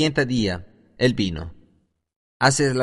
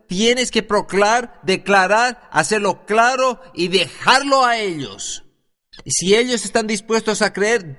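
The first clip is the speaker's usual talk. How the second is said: fervent preaching; 4 semitones higher than usual. Acted. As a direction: drive the point home like a revival preacher. Preaches with rising fervour.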